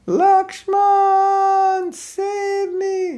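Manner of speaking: high, loud, strained yell in a false voice; desperate cry for help. Retold or imitated. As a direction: scream for Lakshman as if dying. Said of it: "the demon yelled out".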